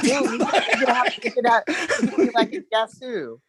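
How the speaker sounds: giggling through the words, high; laughing. Real, not acted. To friends, joking about a Guess Who game, laughing. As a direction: laugh while pitching the silly idea.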